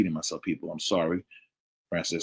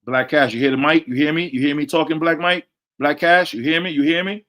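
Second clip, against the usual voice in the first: high, raised voice checking the line; insistent calling out; 7 semitones higher, 6 dB louder than usual. Real, not acted. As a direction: call each listener, pitched up and questioning. Calling listeners over faulty audio.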